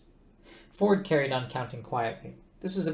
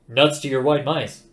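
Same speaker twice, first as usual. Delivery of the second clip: gruff dismissal; 9 dB louder than usual. A narrator's reading. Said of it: "An expression ... failed to cross".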